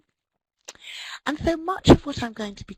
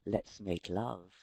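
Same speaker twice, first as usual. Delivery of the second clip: low, slow seductive murmur; 12 semitones lower, 9 dB quieter, slower than usual. Acted. Lecturer spells out what the etchings invitation means, low and seductive. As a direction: drop the voice right down for a slow come-on.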